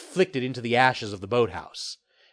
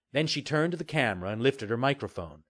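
usual speaking voice

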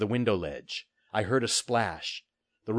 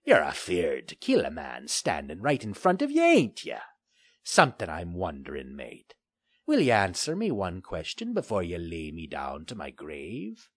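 put-on a taunting old sea pirate; gruff pirate; 2 semitones lower than usual. Pirate Finley voiced.

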